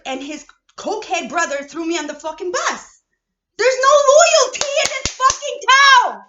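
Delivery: real furious yell; betrayed rage. Real, not acted. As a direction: spit it out at the people who left you. Raging about betrayal.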